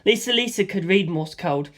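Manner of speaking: plain reading voice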